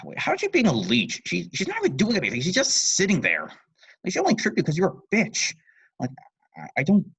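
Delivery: high, loud outraged commentary; incredulous aside. Real, not acted. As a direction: break character in disbelief. Reader breaks off to comment.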